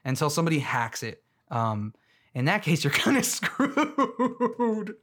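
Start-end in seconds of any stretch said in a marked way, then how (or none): 2.8-5.0 high, slow words through a laugh. Real, not acted. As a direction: giggle through it. Laughing at own joke.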